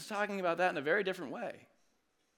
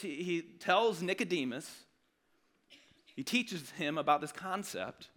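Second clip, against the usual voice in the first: usual speaking voice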